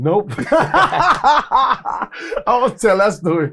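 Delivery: high, loud and drawn out through laughter; amused, laughing protest; real laughing refusal. Real, not acted. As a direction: laugh it off and dodge the question. Laughingly dodges a teasing question.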